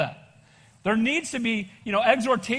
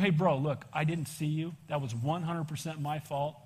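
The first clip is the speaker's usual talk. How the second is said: soft, humble, pleading; apologizing to the angry driver, soft and sincere; sincere apology; 6 semitones lower, 7 dB quieter than usual. Retold or imitated. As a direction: say sorry from the heart, quietly. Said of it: "I go".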